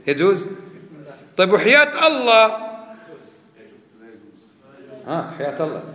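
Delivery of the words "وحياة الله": loud, high emphatic oath; swearing an oath. Acted. Teacher asks whether swearing by God's life is allowed, emphatic oath.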